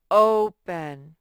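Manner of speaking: "open" with a big, loud, long OH; vowel modelling. Shown as a demonstration. Teacher showing children the long O, emphatic vowel modelling. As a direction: stretch the first syllable of "open". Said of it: "I hear an O".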